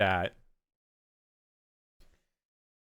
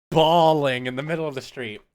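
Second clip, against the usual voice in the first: ordinary talk, nothing marked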